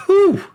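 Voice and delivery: high, loud exhaled whoop of relief; relieved whew. Real, not acted. Player after beating the boss, relieved whew. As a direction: blow out a big high "whew". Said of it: "What a relief".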